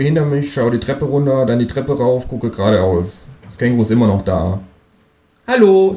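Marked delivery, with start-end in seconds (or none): 5.5-5.9 much higher, louder cartoon kangaroo hello. Kangaroo at the door greeting the narrator, much higher and louder. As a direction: greet him brightly as the kangaroo, pitched way up. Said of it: "sagt das Känguru".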